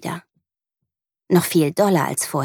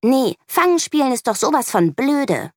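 put-on higher, sulky child snapping; jealous girl refusing to play tag, higher and sulky; hissing back; 8 semitones higher than usual. A narrator's reading. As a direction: spit it out like a cross little girl. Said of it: "faucht sie".

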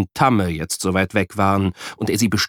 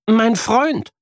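put-on high child voice, small; shy kid answering; mild child murmur; mumbling boy; 13 semitones higher than usual. A narrator's reading. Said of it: "murmelt Jan".

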